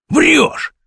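high, sudden accusation; accusing outburst. A narrator's reading. Kurochkin disbelieves Vasya's warning, accusing. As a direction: throw it back, pitch leaping.

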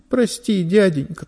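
put-on high, small voice of a pleading boy; plaintive plea. A narrator's reading. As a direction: beg the merchant wistfully. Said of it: "тоскливо сказал вор".

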